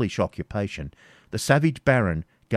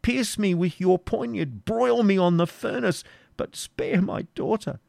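put-on high, trembling old father begging for mercy; 12 semitones higher than usual. Isaac begs the Norman baron to spare his daughter, high pleading voice. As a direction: beg the baron to spare your child.

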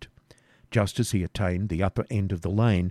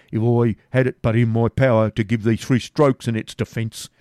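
raised, defiant swineherd voice; defiant Garth; 3 semitones higher, 6 dB louder than usual. Acted. Garth defies his captors, louder and stubborn. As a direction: grumble it out loud and stubborn.

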